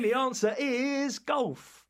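slow, drawn-out big announcement; dramatic reveal. Acted. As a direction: stretch it out like a game show host. Quiz host's dramatic answer reveal.

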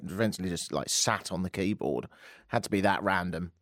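usual speaking voice

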